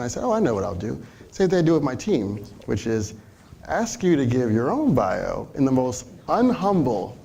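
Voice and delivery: ordinary delivery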